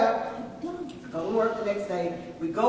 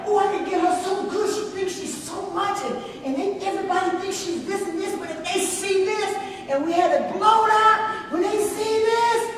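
gleeful scheming; 9 semitones higher than usual. Retold or imitated. Telling the Lord how she would get her coworker good, gleeful and high. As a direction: relish the thought of getting her good. Retold scheming about the poster.

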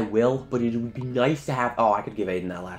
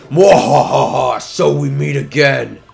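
slow, loud hammed-up villain laugh and gloat; slow theatrical villain menace; 2 semitones higher, 10 dB louder, slower than usual. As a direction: cackle then gloat like a cartoon villain. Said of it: "It's Edna from The Incredibles".

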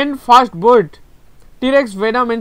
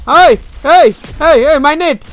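urgent, raised-voice heys; alarmed calling out; 4 semitones higher, 4 dB louder than usual. Real, not acted. Streamer protesting about the net mid-game, alarmed. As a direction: call out in alarm, higher and louder.

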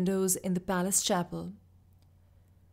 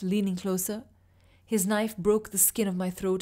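ordinary delivery

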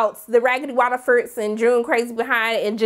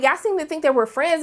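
ordinary talk

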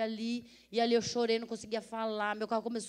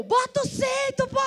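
high, loud retold yell at the passenger; mock shout; 10 dB louder than usual. Robber yelling in car chase.

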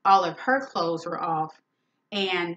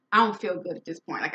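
ordinary talk, nothing marked

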